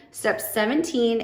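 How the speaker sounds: usual speaking voice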